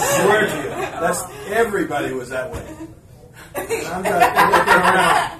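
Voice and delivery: loud and high, laughing while telling; can't stop laughing as you tell it; real laughter through the words. Real, not acted. Laughing at the memory.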